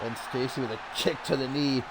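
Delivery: ordinary talk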